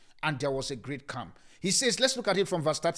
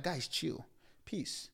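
laid-back calm; 8 dB quieter, much slower than usual. Acted. Jesus telling the frightened guys to chill, soft. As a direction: tell them to chill, softly. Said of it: "that's how we say it".

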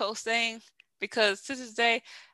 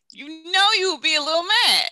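high, loud teasing challenge; playful, high-pitched insistence; raised octave; 7 semitones higher, 8 dB louder than usual. Shown as a demonstration. Follows talk of raising octave high.

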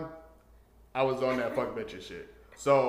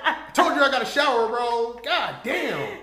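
loud, higher, laughing yell to hurry her; exasperated shout; 13 semitones higher, 12 dB louder than usual. Retold or imitated. Friend telling a hookup story yells at her to hurry up, laughing exasperated shout. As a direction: bark at her in laughing frustration.

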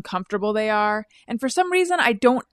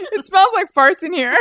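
groggy husband; 8 semitones higher than usual. Retold or imitated. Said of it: "I think you said".

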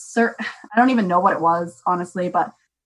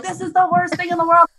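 higher, whiny mock-parent complaint; mock complaining parent; 8 semitones higher than usual. Mom mocking other parents who hate online sessions, whiny and higher. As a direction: parody the grumbling parents, pitched up. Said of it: "All these other parents are like".

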